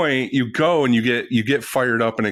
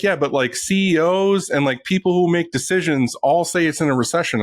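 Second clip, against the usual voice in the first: mocking economist voice, pitched up and breezy; 7 semitones higher than usual. Guest mocks the cheery economist, raised mocking tone. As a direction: sends up the upbeat economist for his podcast cohosts. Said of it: "then he's like".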